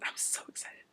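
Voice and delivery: hushed, breath-only whisper of glee; excited whisper. Real, not acted. Vlogger to viewers after shouting about her show, excited whisper. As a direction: drop to a tiny whisper, barely containing excitement.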